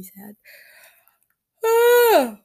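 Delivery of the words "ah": loud, high yawning ah; stretching yawn, sound held; big yawn. Real, not acted. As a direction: let a real yawn escape out loud. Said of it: "sorry for all the yawning".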